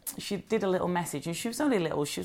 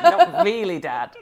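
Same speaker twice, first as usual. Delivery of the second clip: higher, louder, laughing retort; mock protest; 5 semitones higher, 8 dB louder, slower than usual. Answering her dad's Cockney jibe, mock protest. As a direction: push back with a laughing objection.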